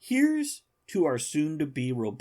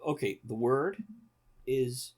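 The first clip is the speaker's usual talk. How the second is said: ordinary delivery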